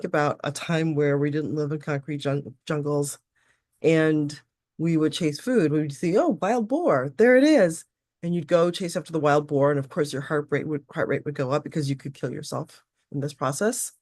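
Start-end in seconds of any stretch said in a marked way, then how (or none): 6.2-7.8 excited spotting. Retold or imitated. Hunter spotting a wild boar.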